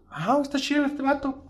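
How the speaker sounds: high mock voice of a booker impressed by a new comic. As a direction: lightly play the booker who likes what he sees. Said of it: "Dices tú".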